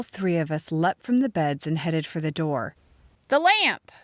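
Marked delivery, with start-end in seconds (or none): 3.3-3.9 high, loud urgent yell. A narrator's reading. Sarah Jane yelling to the girls in the dark, urgent. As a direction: shout it as if grabbing for a light. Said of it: "Sarah Jane yelled".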